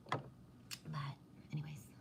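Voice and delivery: soft whispered aside. Real, not acted. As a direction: breathe it out unvoiced. Drops to a whisper.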